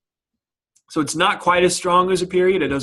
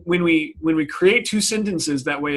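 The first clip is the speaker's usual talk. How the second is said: ordinary delivery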